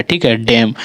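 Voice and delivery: ordinary delivery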